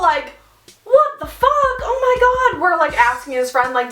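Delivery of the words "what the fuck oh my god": high-pitched mock shock; shocked reaction. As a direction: act out stunned alarm, way up high. Retells group's shock at a collapse.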